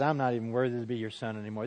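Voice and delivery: soft, quick, broken confession; a humbled son, barely above a murmur. Retold or imitated. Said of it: "He comes back humbled".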